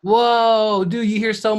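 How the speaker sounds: loud, high amazed whoa; excited whoa. Real, not acted. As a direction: blown away by the news. Host reacts to Egypt.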